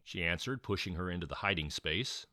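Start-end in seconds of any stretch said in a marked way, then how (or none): none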